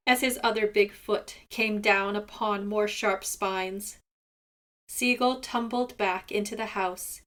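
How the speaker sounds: ordinary delivery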